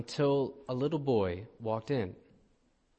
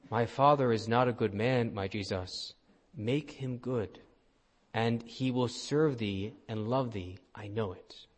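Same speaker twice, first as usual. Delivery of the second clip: ordinary delivery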